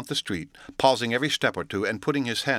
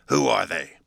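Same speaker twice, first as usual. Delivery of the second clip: hoarse demand; 4 dB louder than usual. A narrator's reading. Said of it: "a little hoarsely".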